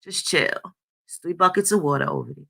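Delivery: mock easygoing reassurance; laid-back men. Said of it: "They're like".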